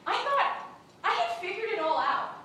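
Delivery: high, loud comic epiphany; excited realization. Retold or imitated. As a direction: build to a loud, triumphant discovery. Acts out her excited realization.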